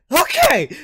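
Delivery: very high, loud yelp; psyched-up shout. Acted. Captive about to run into the crowd, shouting himself on. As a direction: burst out, working up your courage.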